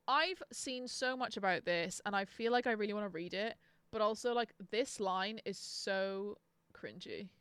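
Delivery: usual speaking voice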